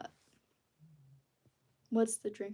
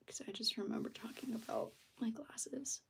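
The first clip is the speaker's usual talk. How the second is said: whispering; 9 dB quieter than usual. Real, not acted. Muttering to herself.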